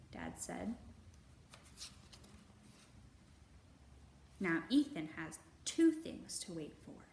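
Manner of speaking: put-on gentle, measured read-aloud for children; storybook narration. A narrator's reading. Librarian reading a picture book to kids, gentle narration.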